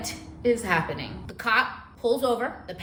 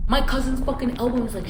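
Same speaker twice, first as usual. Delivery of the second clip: ordinary delivery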